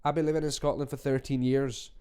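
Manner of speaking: ordinary delivery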